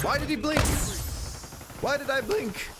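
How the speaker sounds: high-pitched incredulous lament; high, frustrated outcry; exasperated cry. Real, not acted. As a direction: wail the question in frustration. Exasperated at missed shot.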